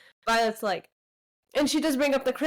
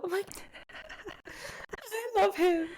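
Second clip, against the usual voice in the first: laughing; 10 semitones higher, 6 dB quieter than usual. Real, not acted. Gushing over a favourite character.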